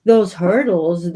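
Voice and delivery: ordinary talk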